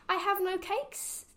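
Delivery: high, small rabbit voice; timid rabbit. A narrator's reading. Rabbit answering the highway rat, in a high little voice. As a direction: squeak it out like a nervous little rabbit. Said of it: "the rabbit replied".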